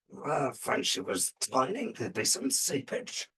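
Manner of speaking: slow mad-surgeon voice; mad professor. Retold or imitated. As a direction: draw it out as a crazed European professor. Acts the mad European professor.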